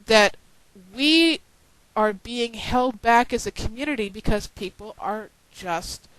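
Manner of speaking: ordinary talk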